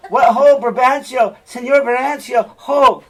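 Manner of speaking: high, loud hail; shouting up to a dark house at night; loud calling out. Acted. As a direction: call up to the window, loud and high. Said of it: "Rodrigo, uh, does this".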